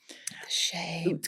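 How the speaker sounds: breathy mock-dramatic whisper. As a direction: echo it as a whisper. Echoes whisper.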